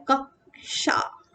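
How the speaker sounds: कक्षा drawn out slowly as "kak... sha"; half k shown. Shown as a demonstration. Teacher showing the half k, slow "kak-sha". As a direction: hold back after the k in कक्षा, then "sha". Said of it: "आधा क बीच में आए".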